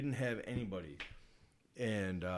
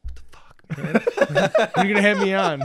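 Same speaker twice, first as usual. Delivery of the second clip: laughing mock plea; 10 semitones higher, 18 dB louder, faster than usual. Mock pleading quote, laughing.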